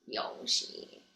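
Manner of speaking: soft whispered name. Acted. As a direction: breathe the name out. Whispered Yoshi repeat.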